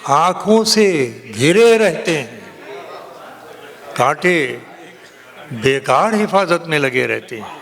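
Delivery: ringing, declaimed Urdu ghazal; poetry recitation. Acted. Poet reciting a ghazal to a cheering audience, declaimed. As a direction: declaim the verse in sweeping phrases, pausing for effect. Declaims couplets, repeats lines.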